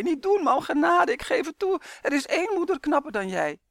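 very high, loud squealed surrender; giggling plea. A narrator's reading. Boy giving in to his tickling mother, giggly squeal. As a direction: beg for mercy as if tickled. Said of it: "giechelde hij".

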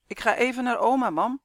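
high, loud quick announcement; hurried. A narrator's reading. Son slipping away to grandma's, hurried. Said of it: "zei Roy vlug".

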